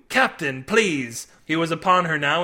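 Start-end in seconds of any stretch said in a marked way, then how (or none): none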